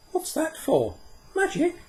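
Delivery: put-on high, bright girl asking eagerly; a young girl piping up with a question; child's voice. Una asks Puck eagerly, high girl's voice.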